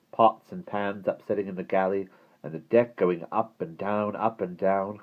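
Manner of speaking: a touch of teasing glee; lightly voiced wicked grin; wicked relish. A narrator's reading. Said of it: "Simon grinned wickedly".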